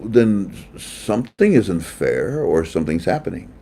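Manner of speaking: ordinary talk, nothing marked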